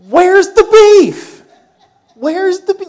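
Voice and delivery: put-on loud, high-pitched old-lady catchphrase; funny older lady. Pastor imitating an old lady's burger commercial, comically loud and high. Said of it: "older lady who was really kind of funny in the way she said it".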